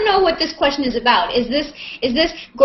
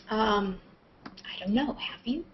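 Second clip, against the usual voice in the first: whisper; 9 dB quieter than usual. Real, not acted. Aside to hangout viewers, whispered.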